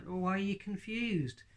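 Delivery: ordinary talk, nothing marked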